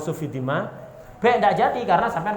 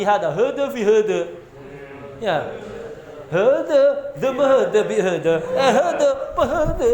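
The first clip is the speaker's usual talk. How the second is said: mock confused pilgrim; 5 semitones higher than usual. Teacher mocks a pilgrim who knows no Arabic, high and puzzled. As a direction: ham up a bewildered hajj pilgrim.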